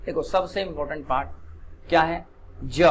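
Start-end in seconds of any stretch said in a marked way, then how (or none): none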